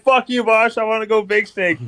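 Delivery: high laughing imitation of a hockey rival; laughing mock tough guy.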